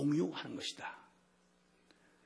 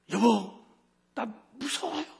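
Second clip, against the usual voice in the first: retold tearful plea; 7 semitones higher, 4 dB louder, slower than usual. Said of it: "울음을 터뜨리면서 하는 말이".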